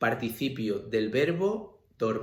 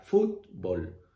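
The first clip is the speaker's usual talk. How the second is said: fútbol in two beats, FÚT then a lower bol; stress on first syllable; 4 semitones lower than usual. Shown as a demonstration. Teacher contrasting syllables for students, stress on FÚT in fútbol. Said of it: "El golpe de voz es en foot".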